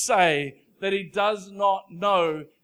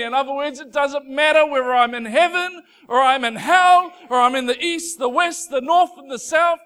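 louder, rising psalm reading building to a shout; full-force preaching; 4 semitones higher, 9 dB louder than usual. Acted. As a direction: proclaim it, building to a cry.

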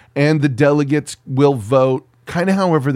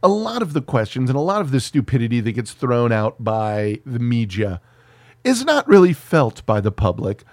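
ordinary talk, nothing marked

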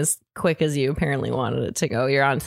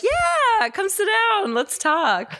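very high, gushing mock invitation; eager welcome; much slower than usual. Woman welcoming guy at coffee shop, hammed-up eagerness. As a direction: squeal it like you're thrilled he came over.

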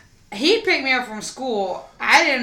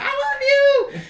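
much higher tearful i love you; mock crying. Mom comforting daughter, high mock crying. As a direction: cry it out high. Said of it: "and crying".

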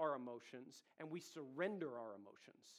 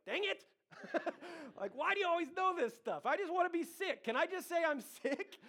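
much higher and louder, whiny through laughter; laughing whine; 11 semitones higher, 6 dB louder than usual. Retold or imitated. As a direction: play the caught-out husband, whining and laughing. Caught out by wife, whining.

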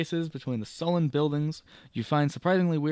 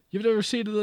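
higher Irish, breaking off; Irish landlady; 8 semitones higher, 6 dB louder, faster than usual. Acted. May boasting of the beacon, Irish lilt. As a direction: start in the lilt then stop. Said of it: "let me go full Irish".

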